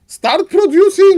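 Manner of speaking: high, loud pleading yell; exasperated shout. Acted. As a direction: scream at him to spend the gold. Caster urging player again.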